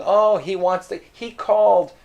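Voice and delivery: mock-surprised clerk guessing; thinking aloud as Pierre. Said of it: "he's already thinking".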